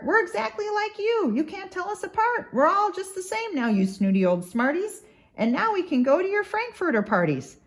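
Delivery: high, excited yelling taunt. Acted. Newly starred Sneetches yell at the snooty ones, high and taunting. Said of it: "they yelled".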